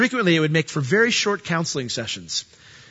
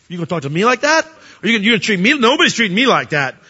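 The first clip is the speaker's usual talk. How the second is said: louder, higher offended comeback; hammed-up indignant outburst; mock angry retort; 5 semitones higher, 5 dB louder, faster than usual. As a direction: bristle back like someone who's been insulted. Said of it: "And we lash out".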